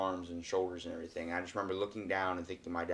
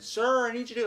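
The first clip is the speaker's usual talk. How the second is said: high, raised-voice call to a swimmer; yelling lifeguard; 14 semitones higher, 5 dB louder than usual. Retold or imitated. Lifeguard calling dad out of the water, yelling. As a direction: call out loudly, pitched way up. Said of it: "like, yelling at him".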